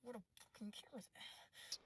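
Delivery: unvoiced, hushed curse; whisper. Real, not acted. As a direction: hiss the question under your breath. Whispered aside.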